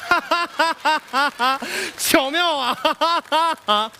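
hearty laugh at own joke; big staged laugh around a delighted remark; self-satisfied laughing. Acted. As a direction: crack yourself up and admire your cleverness.

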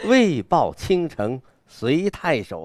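proudly; bold recital. Shown as a demonstration. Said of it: "你看这个狂". (豪迈地朗诵)

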